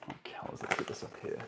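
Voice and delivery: mutters under his breath; whispered aside. Real, not acted. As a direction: whisper it to yourself. Muttering to self while working.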